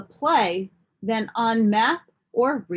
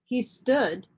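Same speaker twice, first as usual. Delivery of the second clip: ordinary delivery, nothing marked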